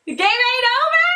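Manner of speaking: very high, questioning rise; exclaimed as if it were a question; voice going up. Real, not acted. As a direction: push the pitch right up at the end. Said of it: "I know my voice went up".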